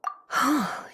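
a soft sharp intake of breath; surprised gasp. Acted. Teacher amazed by the boy's memory, soft gasp. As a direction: gasp in quiet wonder.

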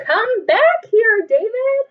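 very high, loud, slow shout; calling out. Acted. Mom chasing David out the door. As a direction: call after a runaway child.

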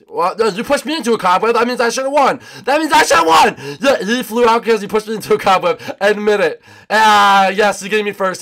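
high, shouted accusation; loud protest. Real, not acted. Cheated racer accuses the winner, high loud protest. Protests losing to a cobweb push.

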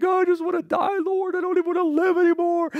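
very high, exaggerated wail; mock crying. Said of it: "he went and he cried".

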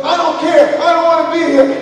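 high, loud defiance; bratty retold shouting; child yelling back. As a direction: imitate a kid's tantrum.

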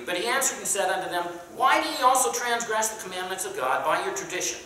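fast formal Bible reading; King James read-aloud; scripture reading. Acted. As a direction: read the verse out to the class, quickly. Reads scripture aloud to class.

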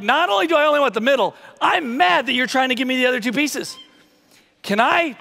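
high-pitched indignant monkey; hammed-up, mildly angry protest; mock offended. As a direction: complain as if insulted by the offer. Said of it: "offended and angry that he was even given".

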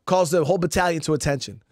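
ordinary delivery

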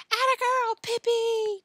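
very high, loud crowd cheer; shrieked cheer. A narrator's reading. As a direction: shriek your support from the stands. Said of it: "began to shriek".

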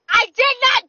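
high, loud shouted denial; loud protest. Real, not acted. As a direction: shout back in indignant protest. Shouted protest during timed game.

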